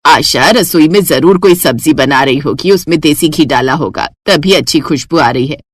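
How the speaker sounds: dismissing it as the maid's cooking; mother-in-law. Acted. (सास की धीमी, भारी आवाज़)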